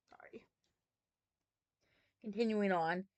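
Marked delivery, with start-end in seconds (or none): 0.1-0.5 soft laughing whispered apology; whispered sorry. Real, not acted. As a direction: breathe it out with a laugh.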